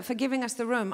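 ordinary talk, nothing marked

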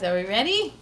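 plain reading voice

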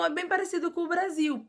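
normal talking voice

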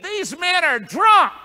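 high, loud, slow mocking jeer; mocking shout. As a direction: jeer at them as drunkards. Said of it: "Others mocking said".

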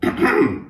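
polite little cough; throat clearing. Acted. Cricket interrupting Gerald, a polite throat clearing. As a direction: clear the throat to get attention. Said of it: "coughed the cricket".

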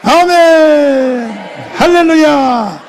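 a long, loud drawn-out amen; stretched preaching cry. Real, not acted. Preacher rousing the congregation with a loud, drawn-out call. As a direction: call it out to the whole hall.

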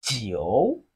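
"jiu" dipping low, then rising; third tone. Shown as a demonstration. Teacher models Chinese nine for learners, third-tone "jiu". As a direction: draw out "jiu" from low pitch to a rise. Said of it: "It has the third tone".

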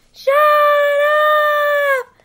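drawn-out loud scream; screamed interruption. A narrator's reading. Toad cuts off the strange voice, screaming. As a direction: scream over the announcer to stop it. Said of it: "cried Toad".

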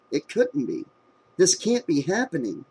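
ordinary delivery

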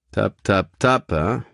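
a hint of a gruff cab driver; lightly voiced cabbie. A narrator's reading. Taxi driver asking his passenger the house number. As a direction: play the chatty taxi driver.

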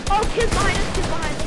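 high, loud warning; urgent callout. Real, not acted. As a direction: yell a warning to your teammates. Warns team of enemies.

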